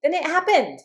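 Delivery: building to high, loud, delighted relief; acted breakthrough excitement, climbing in pitch; excited answer. Acts out a student's excited answer.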